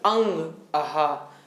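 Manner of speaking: अंग and आहा said slowly and softly with nasal contact; अंग आहा demo. Shown as a demonstration. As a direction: hold अंग and आहा to feel the mouth touch. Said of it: "बोल करके देखें". (अंग, आहा धीमे से बोलकर)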